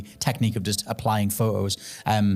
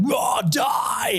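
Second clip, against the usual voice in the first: high shouted mock monster roar. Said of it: "evil monster Ock is just going".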